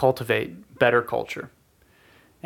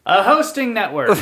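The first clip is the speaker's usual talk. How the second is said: laughing; 12 semitones higher than usual. Real, not acted. Big reveal after drum roll.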